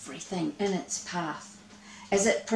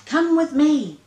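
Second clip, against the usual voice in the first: higher and louder, urgent; rally everyone to flee; crying out; 7 semitones higher, 7 dB louder than usual. Acted. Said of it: "she cried".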